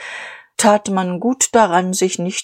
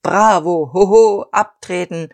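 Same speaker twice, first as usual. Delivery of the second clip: mild exploding cheers; 4 dB louder than usual. A narrator's reading. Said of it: "explodierten die Gefühle der Lieferanten".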